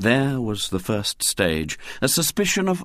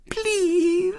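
put-on very high, breathless little-girl pleading; quivering plea. A narrator's reading. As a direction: beg as if tears are coming. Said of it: "in a quivering voice".

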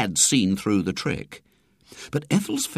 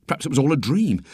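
ordinary delivery

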